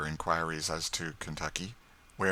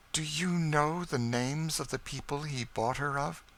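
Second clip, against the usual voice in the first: higher, held-breath question; tense dread; 8 semitones higher than usual. A narrator's reading. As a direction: ask with growing dread.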